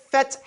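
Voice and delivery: Feth with the h breathed out; air let out. Shown as a demonstration. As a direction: push air through Feth. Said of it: "And we let that air out".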